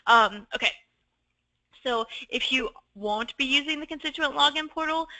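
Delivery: swollen tonsils voice, straining a little; talking through a sore throat. Real, not acted. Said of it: "I might be losing my voice".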